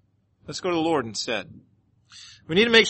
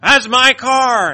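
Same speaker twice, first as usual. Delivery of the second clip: slow, high, loud drawn-out complaint; mock rant; 7 semitones higher, 9 dB louder, much slower than usual. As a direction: stretch out her furious claim. Woman ranting about her car.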